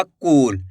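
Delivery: कूल with the oo pulled long; drawn-out vowel. Shown as a demonstration. Teacher shows the long vowel sign, कूल stretched out. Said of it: "खींच के पढ़ा जा रहा".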